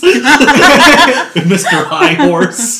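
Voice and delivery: slow, high, laughing taunt; mock him through laughter; laughing tease. Real, not acted. Teasing friend's hypocrisy.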